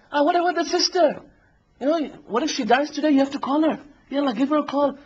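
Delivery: high-pitched, pressing tempter; Shaitan. Acted. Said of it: "And the shaitan says".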